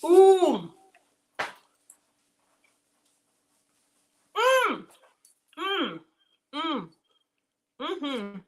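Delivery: high delighted mm sounds; savoring mm. Real, not acted. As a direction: relish the taste with high hums. Savoring hot bite.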